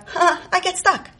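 ordinary delivery

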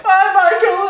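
very high, sing-out farewell; gleeful scare character. Retold or imitated. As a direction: call after your victim in mad delight. In character, seeing Michael off.

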